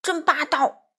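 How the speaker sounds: mildly loud, angry accusation; huffy roar. A narrator's reading. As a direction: roar it back in a huff. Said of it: "珠宝气呼呼地吼道".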